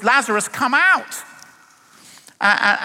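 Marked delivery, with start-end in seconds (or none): 0.0-1.1 calling out loud. Retold or imitated. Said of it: "called in a loud voice".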